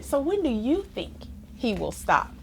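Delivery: ordinary delivery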